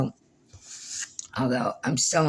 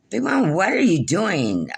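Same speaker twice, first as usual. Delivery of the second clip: high, slow retold question; mock questioning; 8 semitones higher than usual. Cousin asks what she is doing, high and slow. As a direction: draw out the question like a nosy cousin.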